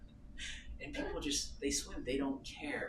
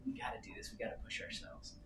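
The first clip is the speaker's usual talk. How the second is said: whispered, determined self-talk; hushed pep talk; 10 dB quieter, faster than usual. Retold or imitated. Couple daring each other to swim, hushed determination. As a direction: whisper the dare to yourself.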